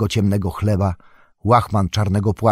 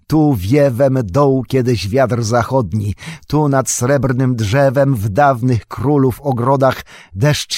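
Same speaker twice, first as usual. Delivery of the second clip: slow, raised, declaimed poem; verse recitation; 3 semitones higher, 4 dB louder than usual. Acted. Aragorn hailing his distant land Gondor, declaiming verse. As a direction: declaim it as a stately lament, higher and drawn out.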